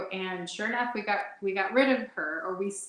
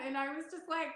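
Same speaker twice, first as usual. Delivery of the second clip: ordinary delivery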